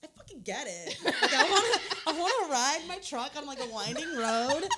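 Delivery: high, loud, laughing mock country fan; laughing mock rapture. Laughing to a friend about loving country music, high excited gush. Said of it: "all of a sudden it's like".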